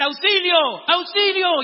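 high, loud yell for help; acted cry for help. Student yelling to the neighbourhood for help, acted shout. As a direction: holler for anyone to come. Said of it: "empiezo a gritar".

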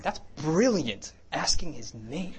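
slow, rising marvel at a brilliant idea; mock amazement. Preacher retelling his reaction to the prompting, amazed. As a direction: draw it out, high and impressed.

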